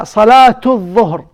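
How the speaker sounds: "Salatu dhuhr" run together with an audible -tu; ta marbuta as t. Shown as a demonstration. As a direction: link "Salatu dhuhr" through a sounded t. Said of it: "As a ta".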